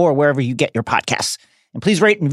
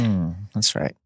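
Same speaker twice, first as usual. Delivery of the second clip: low sultry murmur; 10 semitones lower, 8 dB quieter than usual. Pretend. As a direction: purr it, mock seductive.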